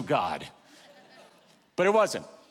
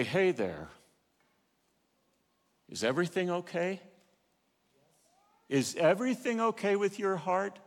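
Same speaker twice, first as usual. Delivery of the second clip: gentle Holy Spirit; 5 dB quieter than usual. Acted. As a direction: ask gently, as a caring inner voice. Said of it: "the Holy Spirit say".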